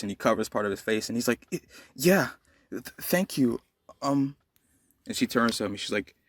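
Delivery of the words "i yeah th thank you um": higher, stammering shy thanks; nervous stammer. Acted. Deku thanking the girl who caught him, flustered stammer. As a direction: stumble over the words, flustered. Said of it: "'cause he's nervous".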